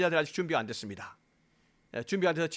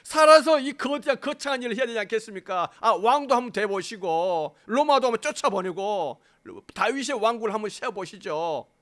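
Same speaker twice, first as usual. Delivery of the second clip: raised, higher acted pleading; Peter's urging protest; 5 semitones higher, 5 dB louder than usual. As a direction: argue loudly and grandly to talk him round. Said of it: "야단치면서". (설득하듯 높고 힘찬 항변)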